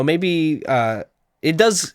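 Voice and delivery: ordinary talk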